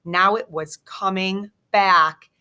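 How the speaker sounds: plain reading voice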